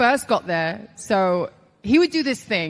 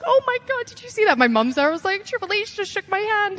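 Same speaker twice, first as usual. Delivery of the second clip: very high excited squeal; starstruck gushing; 11 semitones higher than usual. Retold or imitated. Rookie telling her mum about Triple H, squealing with excitement. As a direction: gush it breathlessly to your mum.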